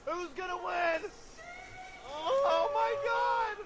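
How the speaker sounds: rising hype; excited shouting. Real, not acted. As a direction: yell at the screen in excitement. Hyped reacting to close race.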